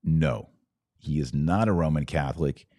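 ordinary talk, nothing marked

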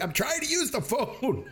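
high-pitched squeal imitating an exasperated caller; exasperated mock plea. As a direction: whine it way up high, half laughing. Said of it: "he, like, shushes everybody".

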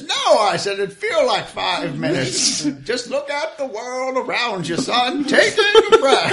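put-on high, airy old sage voice; dreamy old man. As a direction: savor the view like an old sage. Joe's wise old man line.

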